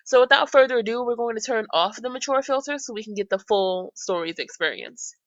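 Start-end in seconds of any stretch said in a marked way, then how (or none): none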